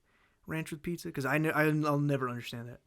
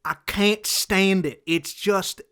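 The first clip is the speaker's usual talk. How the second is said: hammed-up folksy accent, punched hard; put-on drawling accent; 4 semitones higher, 7 dB louder than usual. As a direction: complain loudly in a mock rural accent. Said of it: "doing the accent".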